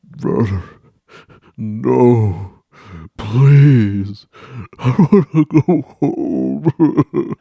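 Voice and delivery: hammed-up child plea; pleading. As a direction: beg to go home. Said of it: "I'm over here".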